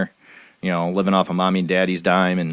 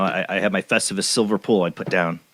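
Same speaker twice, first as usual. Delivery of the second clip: ordinary delivery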